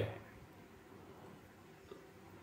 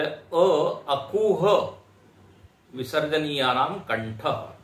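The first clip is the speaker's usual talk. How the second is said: stretched, slow opening syllable, then the rule; drawn-out recitation; 2 semitones higher, slower than usual. Retold or imitated. Teacher quoting the sutra's author, slowly drawn out. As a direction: draw out the first sound as if reciting. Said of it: "तो बोले".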